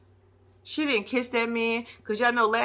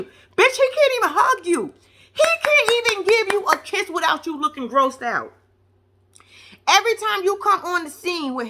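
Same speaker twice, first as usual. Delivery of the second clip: high, raised-voice rant; loud, high-pitched exasperation; exasperated scolding; 8 semitones higher, 3 dB louder than usual. Real, not acted. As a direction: tell her off, pitched way up. Mock-scolding the wife, worked up.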